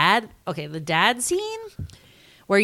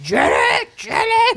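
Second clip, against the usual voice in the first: mock drunken father's shout, rising high; dad yelling; 4 dB louder, slower than usual. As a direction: imitate a father hollering for his child. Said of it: "the dad who".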